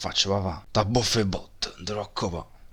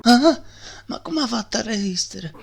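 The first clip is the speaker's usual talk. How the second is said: shocked; 13 semitones higher than usual. Acted. Shocked at resistance.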